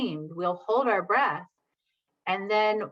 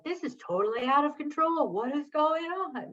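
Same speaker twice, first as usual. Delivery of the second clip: exasperated; 8 semitones higher than usual. Retold or imitated. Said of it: "we're looking at each other and we're like".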